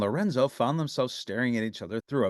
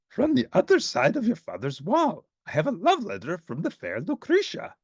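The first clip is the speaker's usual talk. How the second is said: Grover voice; 9 semitones higher than usual. Acted. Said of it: "said Grover".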